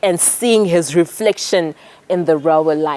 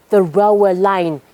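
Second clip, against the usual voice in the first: ordinary delivery, nothing marked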